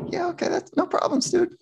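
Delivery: high, airy, unbothered "no problems, dude"; breezy brush-off. Retold or imitated. Shrink answering the athlete's threat, airy and high. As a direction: shrug it off, pitched far up. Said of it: "And I said".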